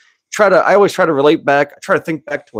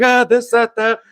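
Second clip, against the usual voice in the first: small panic; 9 semitones higher than usual. Retold or imitated. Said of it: "in, like, a small panic".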